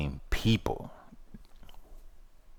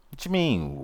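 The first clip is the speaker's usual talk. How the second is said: usual speaking voice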